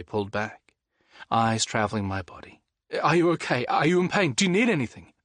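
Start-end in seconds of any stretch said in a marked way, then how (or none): 3.0-5.1 higher, rapid worried questions; urgent concern. Acted. Liam checking injured Jules, high and anxious. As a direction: check on him frantically.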